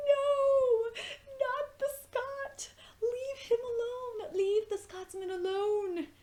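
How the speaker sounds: high, slow, crying wail; anguished plea. Retold or imitated. Reliving watching the Scotsman die, wailing high and slow. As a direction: wail as if begging for his life. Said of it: "And I was like".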